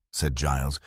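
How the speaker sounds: usual speaking voice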